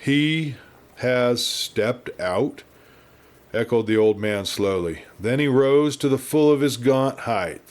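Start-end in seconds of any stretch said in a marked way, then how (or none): 0.0-2.6 very slow, stretched retake; slow echo. Shown as a demonstration. Narrator retakes the old man's line, slower and dazed. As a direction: drag it out, word by word. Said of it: "Let's try that again".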